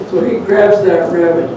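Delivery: plain reading voice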